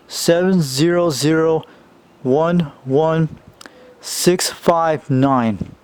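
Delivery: slow, loud digit-by-digit read-out; slow serial number. Real, not acted. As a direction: spell out each digit slowly and loudly for viewers.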